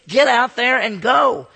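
high, loud and slow, a preacher's charge; rousing exhortation. Real, not acted. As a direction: drive it out to the room slowly. Preacher urging congregation to serve.

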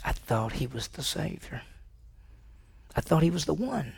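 soft, halting disbelief; dejected disciple. Acted. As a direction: voice a crushed follower quietly. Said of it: "And you may say".

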